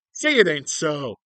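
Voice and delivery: slow, loud hammed-up plea; mock dismay. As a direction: draw it out loudly in fake despair. Mock dismay at doctor's news.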